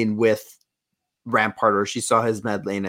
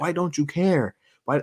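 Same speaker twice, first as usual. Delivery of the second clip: Omega, upset; 6 semitones higher than usual. Retold or imitated. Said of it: "And Omega's like".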